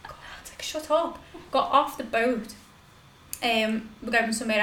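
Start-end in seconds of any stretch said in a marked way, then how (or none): 0.0-1.2 barely voiced hushing; hushed, embarrassed. Urging someone to shut up as people listen, hushed. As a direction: hush them under your breath.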